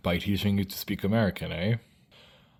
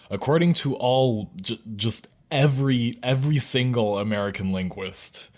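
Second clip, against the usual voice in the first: ordinary talk, nothing marked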